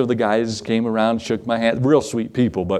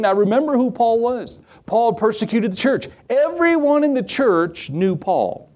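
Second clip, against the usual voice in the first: high-pitched, emphatic pulpit delivery; sustained, raised preacher's voice; preaching; 8 semitones higher than usual. Real, not acted. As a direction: drive the point home to the congregation.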